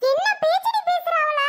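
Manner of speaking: high, sharp rebuke; angry scolding. Acted. Family quarrel, scolding Sathya. (மகளை உயர்ந்த குரலில் கோபமாக திட்டுதல்)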